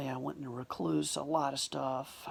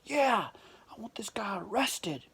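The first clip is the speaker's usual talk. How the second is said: indignant demand; 5 semitones higher than usual. Retold or imitated. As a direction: imitate an aggrieved man demanding action. Man's demand acted out.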